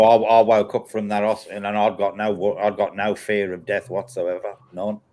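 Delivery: usual speaking voice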